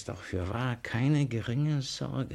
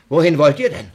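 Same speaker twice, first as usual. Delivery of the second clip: far louder, calling out; startled call; 2 semitones higher, 15 dB louder, faster than usual. Acted. Father catching his children sneaking in, startled call. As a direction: call sharply after them.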